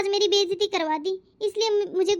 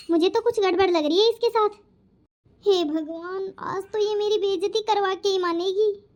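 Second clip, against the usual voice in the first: ordinary talk, nothing marked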